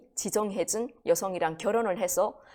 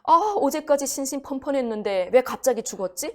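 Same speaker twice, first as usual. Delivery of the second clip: high, loud acted dismay; imitated outcry of bewilderment; bewildered in-law; 6 semitones higher, 5 dB louder than usual. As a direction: protest in disbelief like a stunned relative. In-laws bewildered by sudden death.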